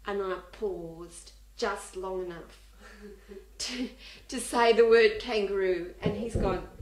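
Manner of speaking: ordinary talk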